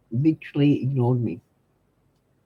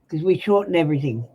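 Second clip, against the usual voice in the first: usual speaking voice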